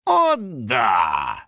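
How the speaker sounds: slow, gloating villain's triumph; low, drawn-out relish; triumphant. A narrator's reading. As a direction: savor the moment of victory. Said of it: "с торжеством произнёс охотник".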